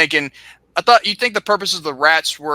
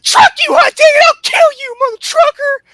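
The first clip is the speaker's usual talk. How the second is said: shrill, loud mock rage, much higher; furious Cornette rant; 7 dB louder than usual. Cornette yelling threats at Bruce over the phone, shrill and loud. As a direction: scream it like a cartoon tantrum.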